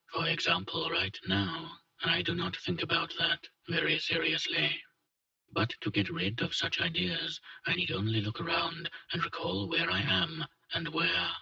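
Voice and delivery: tired voice of someone undying; ancient weary immortal. Acted. Hunter's inner monologue, held voice.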